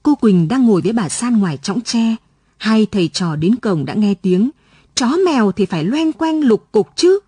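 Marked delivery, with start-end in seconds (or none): 4.9-7.2 pitched-up, needling remark; mild scolding jibe. A narrator's reading. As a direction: toss it out as a pointed dig. Mother sniping within earshot of visitors. (giọng mát mẻ, chì chiết nhẹ)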